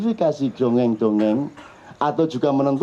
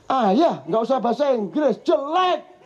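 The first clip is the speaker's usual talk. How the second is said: higher, dismissive bratty reply; imitating a teen child; 6 semitones higher than usual. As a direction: wave off Dad's English as a sulky kid. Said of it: "ngono kan".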